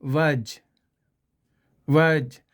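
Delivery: "वज" with no r sound, British style; silent r. Shown as a demonstration. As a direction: say "वज" without the r. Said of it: "इसको कैसे पढ़ेंगे?".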